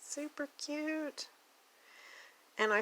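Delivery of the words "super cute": high, soft slow coo; a high, hushed coo of delight. Real, not acted. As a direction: coo it gently, charmed.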